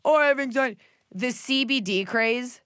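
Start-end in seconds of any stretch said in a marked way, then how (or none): 0.0-0.8 mocking whiny complainer.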